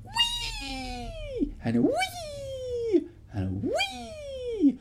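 high gleeful squeals; joyful whee. Acted. Little girl swinging high, gleeful squeals. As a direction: squeal each whee with delight.

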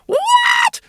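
very high shocked shout; shriek. Acted. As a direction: shriek in outrage. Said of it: "shrieked the governess's assistant".

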